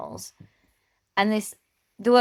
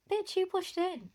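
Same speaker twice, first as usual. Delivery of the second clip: high, slow, softer mock indignation; mock indignant retort; 12 semitones higher, 6 dB quieter than usual. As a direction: imitate an incredulous comeback without raising volume. Acted retort to the queue-jumper.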